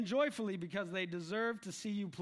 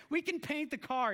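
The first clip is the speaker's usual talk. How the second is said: lightly acted father, pitched up; pleading dad; 7 semitones higher than usual. Dad trying to placate his disappointed daughter, mildly pleading. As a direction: plead a little, imitating a dad. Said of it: "The dad's going".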